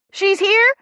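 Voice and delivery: high, excited call from the hallway; loud voice. A narrator's reading. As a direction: announce it loudly from down the hall. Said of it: "comes a loud voice from the hallway".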